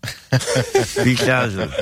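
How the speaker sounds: slow, laughing, tongue held down. Shown as a demonstration. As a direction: try the name holding your tongue. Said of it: "It's when you hold your tongue and say".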